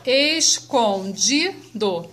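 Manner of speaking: "escondido" slowly in four separate syllables; "escondido" drawn out, one syllable at a time. Shown as a demonstration. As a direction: break "escondido" into four distinct beats. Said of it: "tem quatro sílabas".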